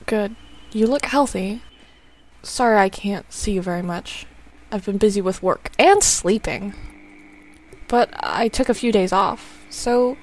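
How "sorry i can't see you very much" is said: ordinary talk, nothing marked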